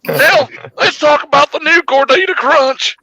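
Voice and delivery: hammed-up, high-pitched holler; mock outburst. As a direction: derail the talk in a high comic voice. Derails talk in comic outburst.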